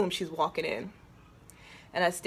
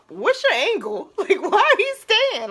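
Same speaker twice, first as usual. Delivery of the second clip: high and loud, laughing disbelief; suspicious laugh; 13 semitones higher, 10 dB louder than usual. Retold or imitated. Woman asking her partner why he stays, loud laughing. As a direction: laugh at him, why stay. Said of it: "I'm still like".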